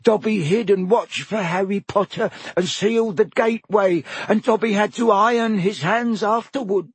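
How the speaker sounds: high, eager elf squeak; house elf voice. Acted. Elf answering Harry, high squeaky voice. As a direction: pitch it up like a nervous house elf. Dobby voice.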